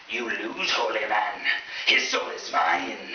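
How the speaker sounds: slow, drawn-out demonic taunt; hammed-up possession voice, stretching into a growl; possessed by the devil. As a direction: claim the soul as a mock demon. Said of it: "rolled my eyes back and said".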